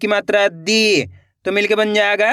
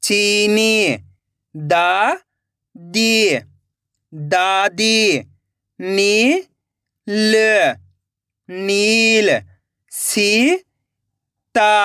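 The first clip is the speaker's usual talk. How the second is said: drawn-out reading; much slower than usual. Shown as a demonstration. Teacher to kids, stretching Sita slowly. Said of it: "तो मिलके बन जाएगा".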